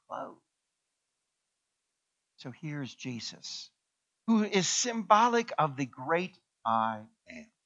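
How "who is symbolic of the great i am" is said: usual speaking voice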